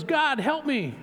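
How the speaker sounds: a mock yell from a man dangling on a rope; desperate yell. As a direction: call up to the sky for rescue. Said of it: "he yells".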